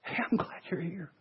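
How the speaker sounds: soft, hushed glad welcome; cheerful welcome. Pretend. God welcoming a guest into his home, soft and glad. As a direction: greet the guest gently, meaning it.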